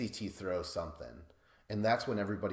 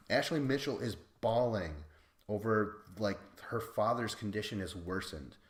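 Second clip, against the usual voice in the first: normal talking voice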